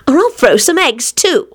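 high-pitched threat; snapped. A narrator's reading. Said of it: "she snapped".

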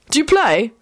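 lightly voiced older boy; curious older boy. A narrator's reading. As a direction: ask it eagerly.

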